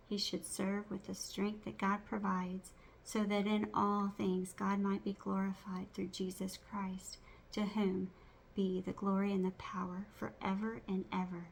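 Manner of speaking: ordinary talk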